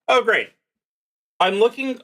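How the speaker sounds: ordinary talk, nothing marked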